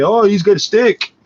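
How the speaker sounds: high, loud and slow, a hammed-up alarmed cop; mock panicky cop. Cops spotting him with a stick, mock alarmed. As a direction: ham up a jumpy cop seeing a threat.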